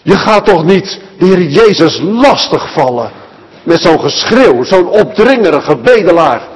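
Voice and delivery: ordinary talk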